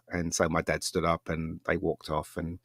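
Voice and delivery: normal talking voice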